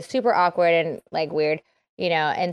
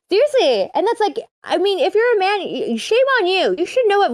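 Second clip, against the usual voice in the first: much higher and louder, mock outrage; high excited scolding; 10 semitones higher, 4 dB louder than usual. Real, not acted. Scolding men in the audience, high excited outrage. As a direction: shame them with a raised, rising voice.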